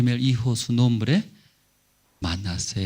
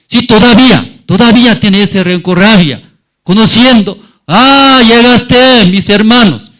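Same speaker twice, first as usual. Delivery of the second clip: high, loud seething rage at the brothers; furious grudge; 11 semitones higher, 15 dB louder than usual. Retold or imitated. Joseph recognizing his brothers, furious high outburst. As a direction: let the old grudge boil over.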